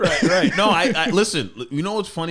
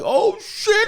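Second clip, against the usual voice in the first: mock amazement; 6 dB louder than usual. Mocking a college guy's delight.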